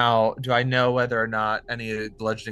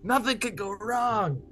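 high, drawn-out cheering; mock overconfidence; 13 semitones higher, slower than usual. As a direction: crow it with hammed-up confidence, stretching the words. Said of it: "so I'm like".

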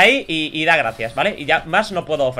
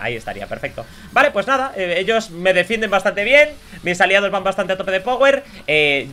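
ordinary delivery

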